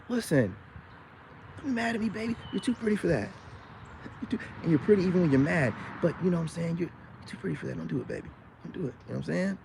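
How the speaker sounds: calm her down gently; hushed flattering plea to a pretty woman; soft sweet talk. Acted. Soothing a mad pretty woman.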